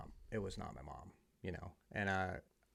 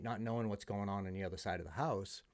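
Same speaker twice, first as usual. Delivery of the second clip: normal talking voice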